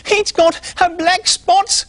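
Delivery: very high, slow mock-scary pig voice; little pig scaring the squirrel, slow and very high; spooky description. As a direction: spin the scary tale slowly.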